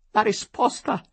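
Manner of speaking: the first cry of the demand; bursting out. A narrator's reading. Tearful wife demands the answer, bursting out. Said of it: "proruppe subito donna Adelaide".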